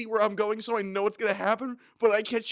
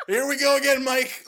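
laughing mock line; 5 semitones higher, 4 dB louder than usual. Mob quote through laughter.

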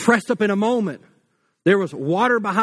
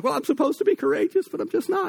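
cowardly lion whimper; 7 semitones higher than usual. Retold or imitated. Cowardly lion admits to Dorothy he has no courage, high whimper. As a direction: whine it like a scared big cat. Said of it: "he's like".

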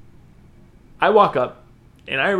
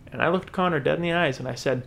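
usual speaking voice

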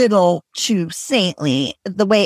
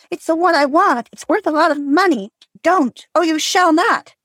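put-on higher, pleading girl's voice; a girl begging her mother to spare the moth, pitched higher; desperate plea; 10 semitones higher than usual. Girl begging her mother to stop, higher pleading voice.

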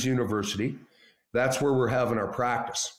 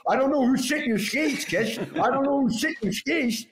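high, odd-voiced trainer imitation; pitched-up trainer voice played for laughs; Patafi impression; 9 semitones higher than usual. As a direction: mimic the trainer's strange way of talking. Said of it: "he sort of sounded like this, eh?".

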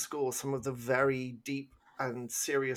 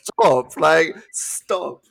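loud pleading "stop"; loud fed-up plea; exasperated shout; 4 semitones higher, 12 dB louder, much slower than usual. Real, not acted. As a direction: yell it at the screen in frustration. Frustrated at soundtrack.

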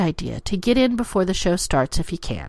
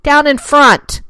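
high, loud, drawn-out heckling yell; mock yell; 8 semitones higher, 9 dB louder, slower than usual. Audience yelling at a late-arriving noob, loud mock yell. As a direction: holler it slowly at someone blocking the view. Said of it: "that we have to yell".

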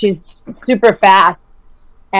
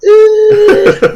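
loud high exasperated ugh; groan of dismay; 12 semitones higher, 7 dB louder than usual. Retold or imitated. Groaning at the friend talking while sprinting, loud and high. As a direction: groan as the fast friend pulls ahead. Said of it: "and I'm like".

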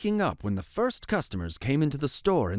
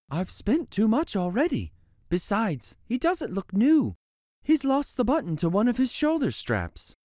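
mother; 10 semitones higher than usual. Acted. Mother voiced in story.

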